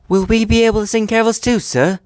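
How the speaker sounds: high-pitched little engine voice, asking; Thomas. A narrator's reading. Thomas asking the Fat Controller about carols, high and hopeful. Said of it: "asked Thomas".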